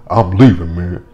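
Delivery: loud mock storm-off. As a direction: announce it loudly as he walks out. Voicing angry husband leaving.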